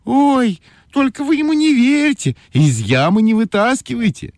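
put-on high, sly girl voice; capricious girl voice. A narrator's reading. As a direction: scheme like a little trickster. Ойка tricks the bear.

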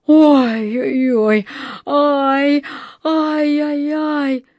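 slow, high, drawn-out ow-ow-ow; wailing in pain. A narrator's reading. Dentist unable to free his finger, wailing. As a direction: moan and yelp over a bitten finger. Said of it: "голосил мистер Лич".

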